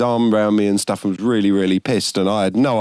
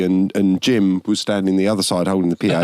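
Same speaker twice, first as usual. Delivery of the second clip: normal talking voice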